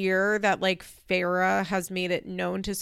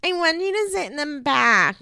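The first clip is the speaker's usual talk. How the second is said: put-on demanding child; 6 semitones higher, 5 dB louder than usual. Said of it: "Sophia's like".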